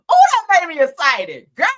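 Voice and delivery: loud giddy outburst; excited gush. Real, not acted. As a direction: let the thrill spill out. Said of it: "that made me so excited".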